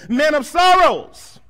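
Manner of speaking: preached reading of Isaiah; proclaimed scripture. Acted. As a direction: intone the verse with gravity. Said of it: "The scripture says".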